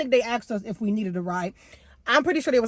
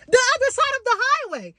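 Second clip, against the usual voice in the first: disbelief; 12 semitones higher than usual. Real, not acted. Disbelief at the location.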